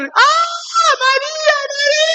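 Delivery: very high imitated shrieks; panicked screaming. Onlookers screaming for the fainted girl, very high mock shrieks. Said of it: "Y empiezan a gritar".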